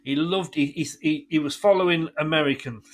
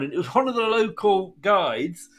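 normal talking voice

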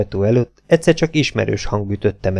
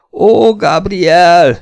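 high, loud, long-drawn wail; screamed exclamation; 8 semitones higher, 6 dB louder, much slower than usual. A narrator's reading. Esmeralda waking to see the jungle, screaming. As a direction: cry out slowly in terror.

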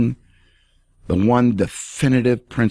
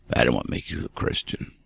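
much softer, lower, nearly whispered; hushed emphasis; 10 semitones lower, 7 dB quieter than usual. Real, not acted. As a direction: press it home in a hush. Preacher drops to hushed emphasis.

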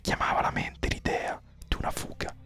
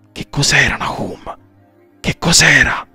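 much louder, urgent questioning; urgent whispered question, voiced out loud; 10 dB louder than usual. A narrator's reading. Emmy questioning the dying Nahum, urgent and loud. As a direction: press the question hard, repeating it. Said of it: "sussurrò Emmy".

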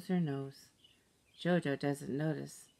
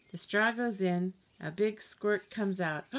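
plain reading voice

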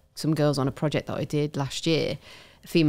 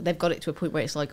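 normal talking voice

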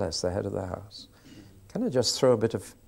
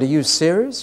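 louder, higher disbelief; surprised; 6 semitones higher, 7 dB louder than usual. Retold or imitated. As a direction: ask it amazed. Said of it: "I said".

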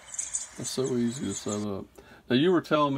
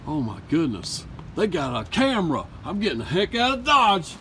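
higher, louder hammed-up panic; mock panic; 8 semitones higher, 9 dB louder than usual. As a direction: flee in fake alarm. Burglar caught, flees.

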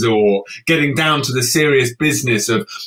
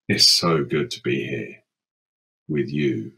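low and quiet; 12 semitones lower, 6 dB quieter than usual. Acted. Refrain, ghostly low take.

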